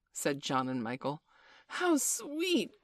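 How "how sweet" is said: put-on high-pitched girlish exclamation; delighted cry. A narrator's reading. Wendy admires Peter's flying, crying out in delight. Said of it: "cried Wendy".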